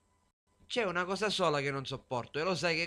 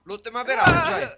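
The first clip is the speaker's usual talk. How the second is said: much louder, high outburst; 9 semitones higher, 9 dB louder than usual. Acted. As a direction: blurt it out loudly.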